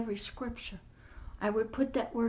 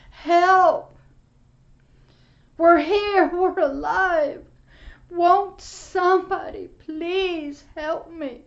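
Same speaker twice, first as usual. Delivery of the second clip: louder, higher pleading screams from the fire; desperate cries for help; 3 semitones higher, 7 dB louder, slower than usual. Retold or imitated. Preacher voicing souls in the flames crying for help. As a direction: beg for rescue, loud and frantic. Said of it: "I could hear the screams for help".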